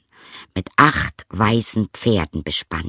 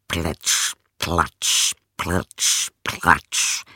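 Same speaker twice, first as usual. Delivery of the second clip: wet footsteps; 5 semitones lower, much slower than usual. Acted. Frog climbing the marble stairs, low wet splashes. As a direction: sound out each wet hop slowly.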